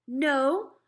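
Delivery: put-on older brother refusing his mother, firmly; boy refusing. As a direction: put your foot down like a fed-up boy. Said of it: "I told her".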